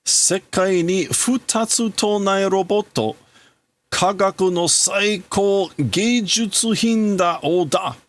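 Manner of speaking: high, slowed, grand boast; boastful scientist. Acted. Scientist boasting of his unique robot, grand. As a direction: boast of a one-of-a-kind robot.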